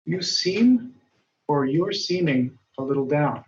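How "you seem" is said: right option. Shown as a demonstration. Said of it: "Li Wu says".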